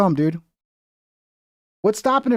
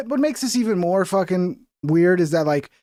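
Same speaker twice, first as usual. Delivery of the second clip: ordinary talk, nothing marked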